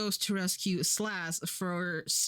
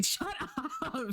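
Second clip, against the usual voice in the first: laughing retort; 8 semitones higher than usual. Real, not acted. Streamer answering a teasing chat comment, laughing retort. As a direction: break into laughter on the words.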